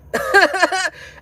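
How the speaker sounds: a loud, high laugh breaking out; high cackling laugh. Real, not acted. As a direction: crack up hard at the story. Laughing at retold Man Show joke.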